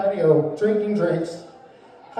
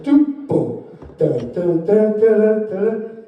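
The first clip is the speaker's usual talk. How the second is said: vocal record-skip sounds; much slower than usual. Retold or imitated. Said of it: "It's like".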